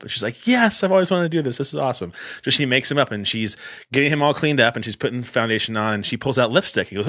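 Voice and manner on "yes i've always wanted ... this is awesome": retold eager girlfriend, pitched up; eager yes. Girlfriend jumping at doing his makeup, eager. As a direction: gush it as an excited girlfriend.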